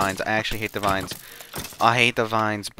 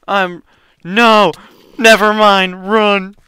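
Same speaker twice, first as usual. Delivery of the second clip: loud high panicked yell; sudden loud panic cry; panicked shout; 8 semitones higher, 12 dB louder, slower than usual. Real, not acted. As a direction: yell in real alarm to run.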